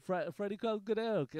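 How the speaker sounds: ordinary delivery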